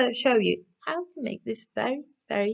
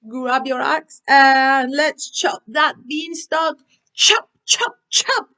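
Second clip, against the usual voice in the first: loud, slowed cheer, then high chop chop; excited call to chop the beanstalk down; rallying shout; 5 semitones higher, 6 dB louder, slower than usual. Real, not acted. Urging kids to chop beanstalk.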